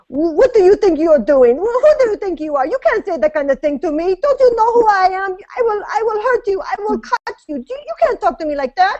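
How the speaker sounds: put-on a tough gangster woman threatening in a Czech accent; Czech accent as Ursula. Guest performs her stripper character Ursula, threatening in a Czech accent. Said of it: "My Czech Republic accent".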